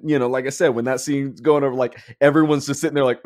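ordinary delivery

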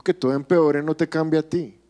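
normal talking voice

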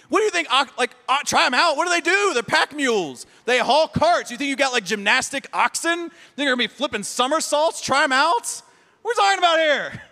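incredulous sarcasm. Real, not acted. Pastor ridiculing the oxen excuse to his congregation, incredulous. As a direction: scoff at the excuse as absurd.

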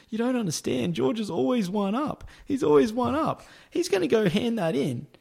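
high-pitched, insistent warning; retold alarmed insistence. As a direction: imitate urgently warning a coworker.

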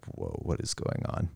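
hushed, breathy whisper of alarm; whispered whoa. Retold or imitated. Teen overhearing from the next room, whispering in shock. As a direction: whisper it under your breath. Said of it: "I'm like".

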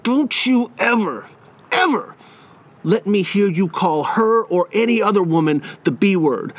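high "hey", then slow, spaced-out scolding; retold teacher laying down the law; bluffing stern warning. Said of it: "to throw in my bluff".

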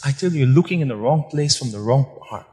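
usual speaking voice

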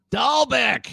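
high, drawn-out, playful call of the name; savoring the name. Real, not acted. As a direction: call it out high, relishing the sound. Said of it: "kinda fun to say".

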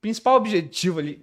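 talking through a choke on a drink; fighting a catch in the throat after choking. Real, not acted. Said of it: "Ó, até me engasguei".

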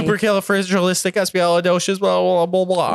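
loud, high, rapid gibberish; mock tongue-twister babble. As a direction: rattle it off loudly and dissolve into babble. Mock attempt at long tongue twister.

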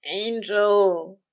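loud, drawn-out pleading whine; petulant whine. A narrator's reading. Crowley begs Aziraphale for sympathy, whining petulantly. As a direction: draw it out, sulky and almost begging. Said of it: "Crowley whined petulantly, an almost pleading tone".

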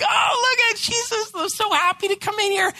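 high, loud gushing delight; mock overjoyed parent. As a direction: gush like a proud dad watching his kid run. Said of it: "And I'm like".

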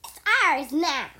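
loud gloating skeleton; gloating shout. Acted. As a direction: crow it out in triumph. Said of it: "one of the skeletons said".

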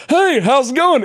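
high, cheery greeting; Goofy voice. Retold or imitated. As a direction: chirp it like a goofy cartoon dog. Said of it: "the guy's like".

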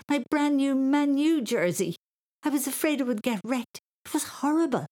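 put-on high, loud boyish dismay; high-pitched horrified child; squealed shock. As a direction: wail over a prized possession. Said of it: "squealed Thomas, quite shocked".

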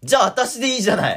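high, loud, laughing plea; jealous wife. Pretend. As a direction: plead as the wife, breaking into laughter. Mock jealous partner quarrel. (笑いながら妻役で訴える)

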